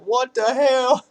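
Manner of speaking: hammed-up Southern accent, stretching HELL out like hail. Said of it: "funny to listen to people say".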